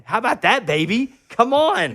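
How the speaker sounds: high, loud, triumphant brag; excited boast. Real, not acted. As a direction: crow about it like a champion. Boasting about injuring himself.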